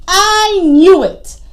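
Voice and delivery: loud, drawn-out outburst; exasperated. Acted. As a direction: burst out slowly and loudly in frustration. Iguana angry, nobody helps.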